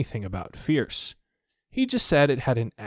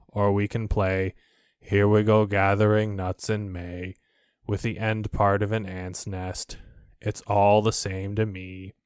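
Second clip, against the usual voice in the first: gloomy Eeyore; 3 semitones lower than usual. Acted. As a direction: drag each word down in weary complaint. Eeyore continues.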